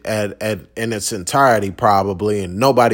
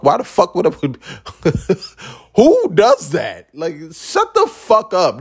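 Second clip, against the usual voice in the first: incredulous; 5 semitones higher than usual. Real, not acted. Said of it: "incredulous outburst".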